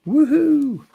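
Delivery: high, loud whoop of delight; a big happy woohoo; excited cheer. Real, not acted. Cheering the Lawrence, Kansas tour date with a loud whoop.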